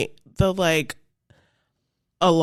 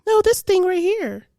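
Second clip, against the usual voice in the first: put-on higher, insistent child; insistent little sister; 11 semitones higher, 3 dB louder than usual. Little sister correcting mom, higher and insistent. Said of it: "My sister's like".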